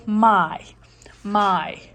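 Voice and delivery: whispered syllable demo of my. Shown as a demonstration. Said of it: "For example".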